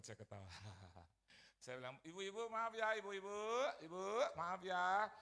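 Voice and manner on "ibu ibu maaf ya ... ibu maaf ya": loud, raised call to the women. Retold or imitated. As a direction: call out an apology over a crowd. Calls out to congregation women.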